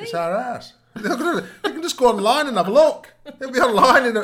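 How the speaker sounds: high, loud laughing rant. Real, not acted. Laughing while ranting about privacy.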